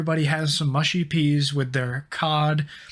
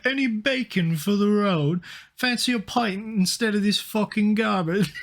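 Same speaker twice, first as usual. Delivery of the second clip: mock whiny Brit, pitched up, dragging the first words; whiny British refusal; 5 semitones higher than usual. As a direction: moan like a picky Brit asking for curry and a pint. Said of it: "they're like".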